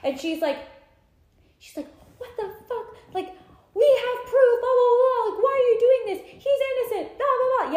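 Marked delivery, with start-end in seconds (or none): 2.2-7.7 high, loud mock yell. Naomi yelling at the pack. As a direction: yell it at them in outrage.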